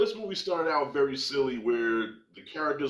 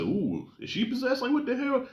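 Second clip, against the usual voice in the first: mock alarmed surprise; 9 semitones higher than usual. Reacting to creepy Glenn Close.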